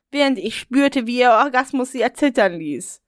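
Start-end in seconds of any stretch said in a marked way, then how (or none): none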